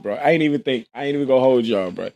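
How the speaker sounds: normal talking voice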